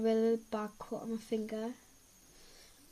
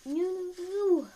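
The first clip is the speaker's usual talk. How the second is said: slow, high, louder groaning no's; slow high dismayed no no no; 6 semitones higher, 5 dB louder, slower than usual. Real, not acted. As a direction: drag out the dismay. Hand in water mishap.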